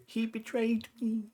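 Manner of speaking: high, hammed-up dying line; overacted death scene. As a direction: play the betrayed corpse, hammy. Dead character speaks in drawing.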